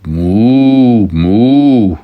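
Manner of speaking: long, loud friendly moos; two drawn-out cow calls; cow mooing. Acted. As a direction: moo like a cow saying hello. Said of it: "so the cow said".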